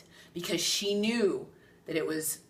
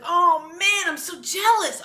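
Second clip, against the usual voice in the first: much higher, louder whiny envy; envious friend; 11 semitones higher, 7 dB louder than usual. Retold or imitated. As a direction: pine like a jealous girlfriend.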